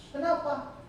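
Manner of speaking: ordinary talk, nothing marked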